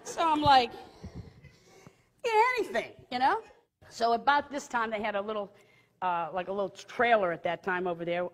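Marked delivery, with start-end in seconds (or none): 2.2-2.9 high-pitched, put-upon answer; childlike reply. Retold or imitated. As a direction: answer like a bratty kid. Childlike answer to coaxing teacher.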